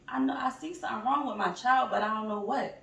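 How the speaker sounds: worried parent. Acted. Voiced parent worried about child.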